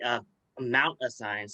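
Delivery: ordinary talk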